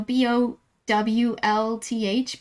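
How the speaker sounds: B O W L T H spelled slowly, letter by letter; slow spelled-out "bowlth"; spelled-out pronunciation. Shown as a demonstration. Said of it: "you know".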